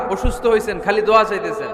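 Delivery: usual speaking voice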